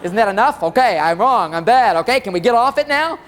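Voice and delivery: loud, impatient mock apology, building at the end; blatant non-apology. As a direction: throw out a sorry just to get it over with. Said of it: "some guys are right out blatant about it".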